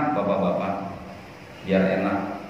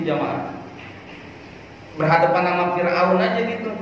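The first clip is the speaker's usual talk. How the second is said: ordinary delivery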